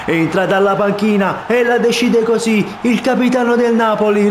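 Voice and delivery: high ecstatic goal scream; breathless italian goal commentary. Real, not acted. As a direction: roar the winning goal to the crowd. Winning goal call.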